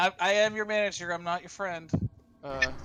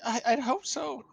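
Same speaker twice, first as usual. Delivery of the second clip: higher, timid stammer; shaky young woman; 8 semitones higher than usual. Acted. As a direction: shaken prisoner thanking. Hannah grateful but shaken.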